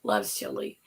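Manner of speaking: deep voice. Real, not acted. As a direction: drop deep for loves chili. Deep echo of loves chili.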